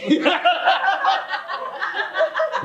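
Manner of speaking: very high squealing laugh; high manic cackle. Retold or imitated. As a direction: let out an unhinged squeal.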